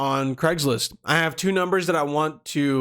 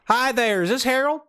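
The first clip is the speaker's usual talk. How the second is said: put-on Southern accent; 9 semitones higher than usual. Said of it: "we're doing the Southern accent".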